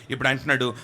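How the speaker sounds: ordinary talk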